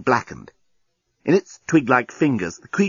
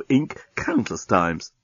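ordinary delivery, nothing marked

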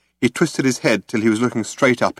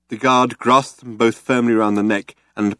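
usual speaking voice

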